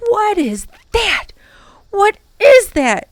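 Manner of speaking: high, rising panic; boy panicking under the giant shadow; panicked gasp. A narrator's reading. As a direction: gasp it out in terror. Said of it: "gasped Ben in a panic".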